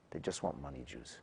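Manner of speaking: a scornful minister muttering to himself; dropped low and quiet with contempt; sneering aside. Acted.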